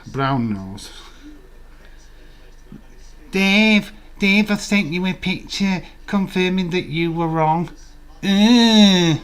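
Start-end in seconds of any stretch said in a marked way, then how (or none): 3.3-9.2 high loud taunting call; mocking chat voice. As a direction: jeer at Dave from the chat. Voicing chat taunting Dave.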